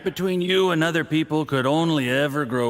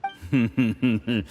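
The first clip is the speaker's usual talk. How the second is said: smug laugh. Acted. Drunk official laughs smugly.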